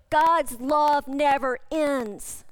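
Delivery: slow, weighty, every word spaced; slow refrain. Real, not acted. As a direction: let each word land one by one. Preacher repeating the refrain slowly.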